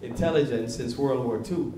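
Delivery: ordinary talk, nothing marked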